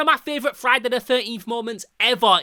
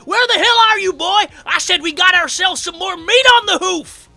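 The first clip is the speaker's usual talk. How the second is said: high, loud hollered scolding; shouting; 10 semitones higher, 10 dB louder than usual. Acted. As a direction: bellow for your missing partner. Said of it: "and he shouts".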